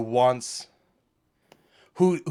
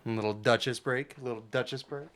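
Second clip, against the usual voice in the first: soft cooing; 2 semitones lower, 8 dB quieter, faster than usual. Real, not acted. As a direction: coo softly to the cat. Cooing at the cat.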